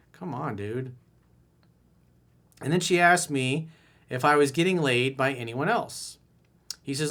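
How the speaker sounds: ordinary delivery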